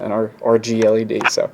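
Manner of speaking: ordinary delivery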